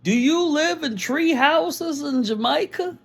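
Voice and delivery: high, slow mocking voice of a clueless questioner; high and slow, hammed-up disbelief; mock ignorant American. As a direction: mimic a naive tourist asking a dumb question. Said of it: "people asking me".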